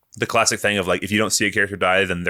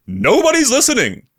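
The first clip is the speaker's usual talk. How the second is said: defiant quote; 6 dB louder than usual. Retold or imitated. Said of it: "And he's like".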